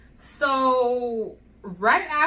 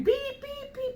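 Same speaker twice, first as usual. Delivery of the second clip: high, even monitor beeps; high-pitched machine imitation; imitated monitor beep; slower than usual. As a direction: beep like a heart-rate monitor. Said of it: "I'm hearing beeping".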